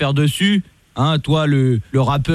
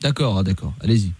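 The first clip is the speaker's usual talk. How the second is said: lightly voiced lower police commissioner; stern police officer; 3 semitones lower than usual. Host posing as police commissioner calling the rapper, stern. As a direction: play a stern cop on the phone. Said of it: "Je suis le commissaire Latour".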